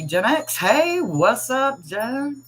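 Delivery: high, slow, sing-song hello; excited greeting. Real, not acted. Streamer greeting a regular viewer in chat, high and drawn out. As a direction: stretch out a bright hello.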